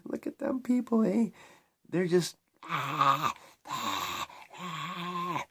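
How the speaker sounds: high-pitched jeering impression; mocking onlookers. Those in power laughing at the crowd, mocking. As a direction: mimic them scoffing at the crowd, with drawn-out wails. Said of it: "they're laughing. They're like".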